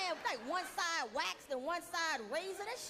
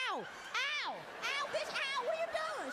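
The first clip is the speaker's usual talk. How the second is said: pained yelping; 10 semitones higher, slower than usual. Retold or imitated. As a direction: yelp in pain and protest. Being slapped on waxed skin.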